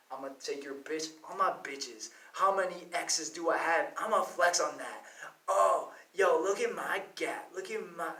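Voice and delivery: lame rapper impression. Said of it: "I kinda started saying it kinda lamely".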